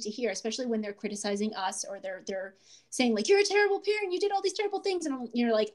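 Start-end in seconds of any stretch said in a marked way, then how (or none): 3.3-5.1 high mock child's complaint; imitating an accusing kid. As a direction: voice the kid's accusation, higher.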